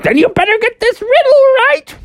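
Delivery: very high villain voice with cackle; talking house. Acted. House threatens Froggy before the riddle, high cackling villain. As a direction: threaten shrilly, then cackle. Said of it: "the house was like".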